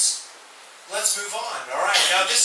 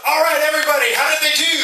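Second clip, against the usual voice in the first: loud, high rallying call; rally the whole room; calling out to class; 7 semitones higher, 7 dB louder, faster than usual. Real, not acted. Teacher rallying class loudly.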